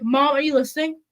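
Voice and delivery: ordinary delivery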